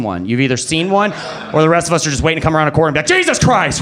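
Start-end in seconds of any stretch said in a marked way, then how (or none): 3.1-3.8 high yelp of mock alarm; startled. Meeting a ghost round a corner, startled cry. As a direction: jump with fright, pitched way up.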